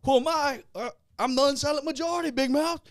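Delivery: high, loud mock tough-guy boast; Paulie. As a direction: crow the comeback like Paulie. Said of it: "He goes".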